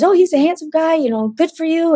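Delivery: high, lightly voiced knowing friend; knowing Leo. Leo teasing her about the handsome guy, high knowing lilt.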